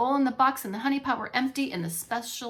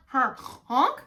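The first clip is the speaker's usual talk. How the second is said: snoring honk; 3 semitones higher, 4 dB louder than usual. Acted. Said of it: "snored Mister Bear".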